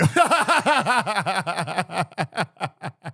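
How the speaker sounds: a long, free, real laugh. Real, not acted. Guest laughing at the host's teasing, real laugh. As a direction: break up laughing at the joke.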